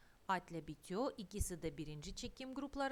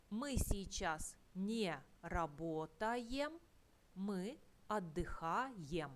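slow model of the correct form; slower than usual. Shown as a demonstration. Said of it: "slow model of correct form".

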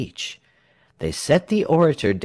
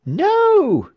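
high, loud shrieked agreement; crowd shriek; 6 dB louder than usual. A narrator's reading. Whole crowd agreeing with the smith, shrieking. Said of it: "shrieked everybody".

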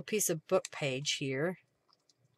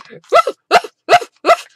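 loud, very high imitated woofs; dog barking; 18 dB louder than usual. Retelling the dog barking behind her, loud high mock woofs. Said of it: "really loud".

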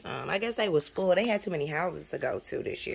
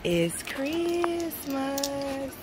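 drawn out; 7 semitones higher, much slower than usual. Real, not acted. Waking kids, sung out.

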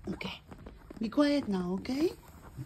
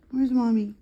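normal talking voice

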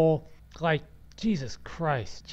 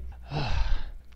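heavy breathy sigh; exasperated sigh; 3 semitones lower than usual. Real, not acted. Podcaster fed up with failed recording, exasperated sigh. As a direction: let out a frustrated breath.

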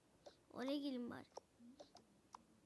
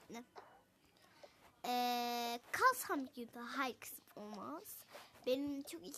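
ordinary talk, nothing marked

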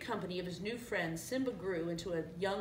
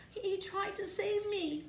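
much higher little cub voice; distressed cub; 12 semitones higher than usual. A narrator's reading. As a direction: stammer a defence in a high voice. Frightened cub answers Scar.

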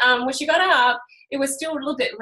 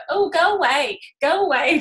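put-on child shooing hornets; 5 semitones higher, 5 dB louder than usual. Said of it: "you can hear her go".

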